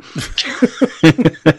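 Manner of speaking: a real, high embarrassed laugh. Real, not acted. Laughing off being called creepy by his co-host, embarrassed laugh. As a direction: laugh it off, caught out.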